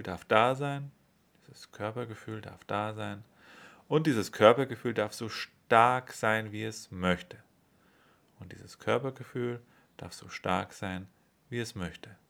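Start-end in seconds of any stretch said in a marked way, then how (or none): 1.5-3.2 the affirmation repeated much softer, fading; soft echo. Shown as a demonstration. Guide echoing the client's inner voice, much softer repeat. 8.4-12.2 the same affirmation again, much softer and fading out; softer repeat. Shown as a demonstration. Guide repeating the permission for the client, much softer.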